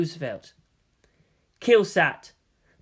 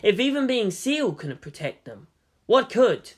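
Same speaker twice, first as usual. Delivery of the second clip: plain reading voice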